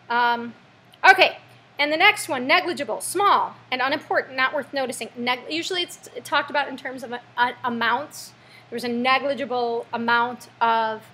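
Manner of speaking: usual speaking voice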